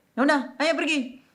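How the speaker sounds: high-pitched mock grudging consent; exasperated mother. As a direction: give in crossly, mimicking Mom.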